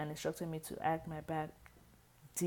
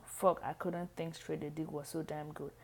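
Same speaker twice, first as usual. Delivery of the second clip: usual speaking voice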